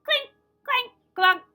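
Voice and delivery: high, loud clink clank clunk; hammer clanks. A narrator's reading. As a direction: make the hammer ring on stone. Hammer sound effects in story.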